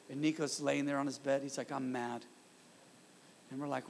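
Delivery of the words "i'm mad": put-on quiet, deadpan quote of a small boy; understated, imitated kid's complaint; matter-of-fact child. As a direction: state the grievance softly, as plain fact. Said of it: "He said it so matter of fact".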